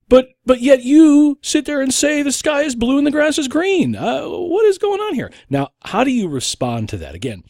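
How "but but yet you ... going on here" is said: loud, high-pitched incredulous accusation; exaggerated disbelief in a high, raised voice; mock outrage. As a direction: ham up an accuser's indignation. Acts absurd accuser confronting you.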